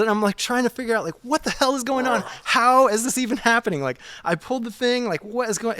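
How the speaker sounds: high, fast, breathless bewilderment; frantic panic. Retold or imitated. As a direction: spiral into disbelief. Retelling panic at the sewage.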